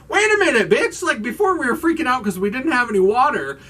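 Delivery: high, loud, incredulous rant; exasperated mock outrage. Viewer scolding characters about the water, mock outraged. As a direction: burst out high, playfully outraged at the absurdity.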